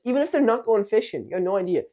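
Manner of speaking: loud, high emphatic aside; insisting it doesn't matter; excited emphasis. Real, not acted. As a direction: push it up, animated. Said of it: "emphatic aside".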